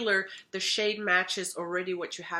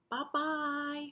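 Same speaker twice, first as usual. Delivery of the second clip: high, soft sing-song bye-bye; cooing goodbye; 7 semitones higher, 5 dB quieter than usual. Acted. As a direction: coo a tender farewell for the pet. Pet says goodbye.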